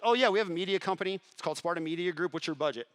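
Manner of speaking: quick, high, eager salesman's reply; unthinking eager yes. Retold or imitated. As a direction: blurt the pitch out instantly, settling on the question. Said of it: "without even thinking about it".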